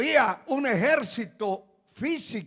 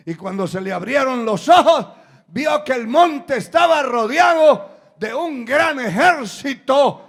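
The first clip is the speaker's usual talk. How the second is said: loud preaching that climbs in pitch; full-force preaching; 4 semitones higher, 9 dB louder than usual. Acted. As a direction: build the miracle into a shout.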